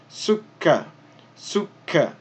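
"sukka" with a held k, stop then release; doubled k. Shown as a demonstration. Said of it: "stopping and then starting again".

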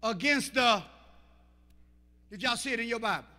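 loud, driving sermon shout; full-force preaching. Acted. Preacher shouting to the church about whining, full force. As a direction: hammer it home to the congregation.

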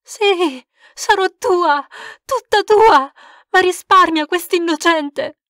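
loud, high pleading that keeps climbing; desperate crying out. Acted. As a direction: beg at the top of her voice to spare the child.

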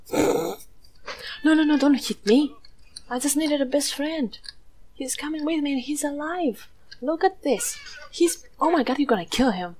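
high pitched begging; high desperate protest; desperate pleading. Real, not acted. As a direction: plead for the goat's life. Enemies attacking the new goat.

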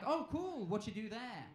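ordinary delivery

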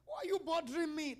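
high, fed-up cry; exasperated shout. Retold or imitated. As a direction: snap back, worn out by the nagging. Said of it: "I say".

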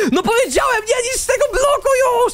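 high-pitched exasperated yell; squawked telling-off; exasperated scolding. Real, not acted. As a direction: scold a teammate, voice shooting up. Scolds teammate for breaking block.

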